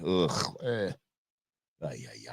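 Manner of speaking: usual speaking voice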